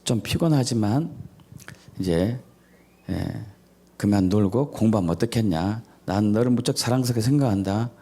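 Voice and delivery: soft, low, loving parent voice; tender parent. Retold or imitated. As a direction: reassure the child gently and lovingly. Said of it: "특히 알파파로".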